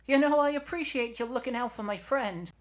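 very high, loud talking-shoulder character; Nomar. Acted. Shoulder complains to Eugenia as she drives, high and loud. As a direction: pipe up loudly way up in falsetto.

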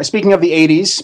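normal talking voice

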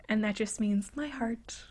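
usual speaking voice

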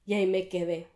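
normal talking voice

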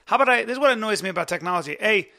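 frustrated near-shout; loud. Real, not acted. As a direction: raise your voice at the machine. Yelling at the robot.

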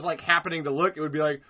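ordinary talk

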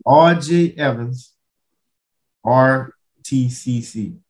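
slow and deliberate. Shown as a demonstration. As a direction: spell it out slowly, letter by letter. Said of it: "I'm gonna slow down for you".

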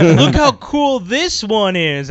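slow, high and excited, selling it; hyped toy ad voice. Retold or imitated. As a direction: pitch the toy like a commercial.

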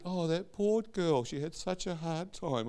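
high, soft, pitying mother voice; sympathetic mother. Retold or imitated. Son mimics his mother pitying a soap character, high and soft. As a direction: imitate a mother cooing with pity, slowly. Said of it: "She said".

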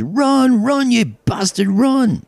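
an overexcited Scot in the bleachers; excited Scotsman yell. Acted. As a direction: bellow at the runner like a new fan. Scotsman yelling at ballgame.

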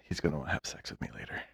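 hushed, unvoiced inner thought; mock whisper. Friend voicing her own thought in a mock whisper. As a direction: whisper it like a dirty secret. Imagined thought about date.

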